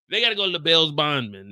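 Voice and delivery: normal talking voice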